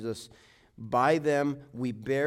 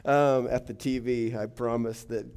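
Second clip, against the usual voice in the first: ordinary talk, nothing marked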